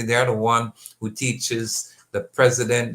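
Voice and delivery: normal talking voice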